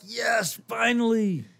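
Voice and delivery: high, loud, slow relieved cheer; crowd cheering. Retold or imitated. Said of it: "everybody's like".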